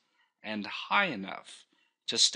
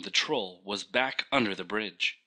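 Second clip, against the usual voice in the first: plain reading voice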